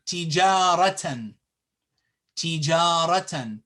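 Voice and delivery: tijaratan with a long held ja; long vowel. Shown as a demonstration. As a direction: stretch "jaa" in tijaratan. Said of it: "we have to extend and lengthen it".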